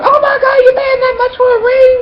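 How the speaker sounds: very high falsetto squeal of disbelief; hammed-up, high-pitched mock shock; shocked friend. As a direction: imitate a friend aghast at a price. Retold friend shocked at ring price.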